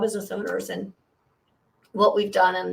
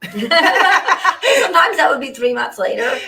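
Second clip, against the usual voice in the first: laughing; 6 semitones higher, 10 dB louder, slower than usual. Real, not acted. Laughing at own slow invoicing.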